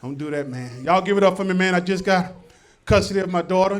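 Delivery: normal talking voice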